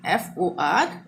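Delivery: normal talking voice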